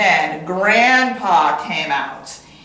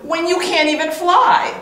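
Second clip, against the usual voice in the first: high, chirpy bird voice; chirped; 8 semitones higher than usual. A narrator's reading. Bird mocking the duck, high chirp. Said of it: "chirped the bird".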